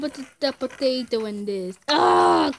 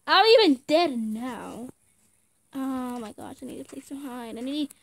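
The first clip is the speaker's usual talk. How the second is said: ordinary talk, nothing marked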